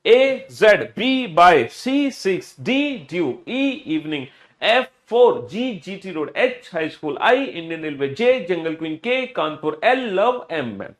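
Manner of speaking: forceful chant, letters punched and stretched; booming start, rhythmic sing-song drill; loud read-along. Shown as a demonstration. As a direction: lead the whole class out loud. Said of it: "फिर से पढ़ो मेरे साथ बेटा जोर से बोलकर".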